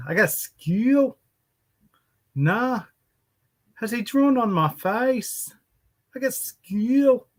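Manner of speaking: high, slow mock Irish child voice; high slow imitation of an Irish girl; Irish girl accent. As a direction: redo the little Irish girl, high and drawn out. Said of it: "with an Irish accent".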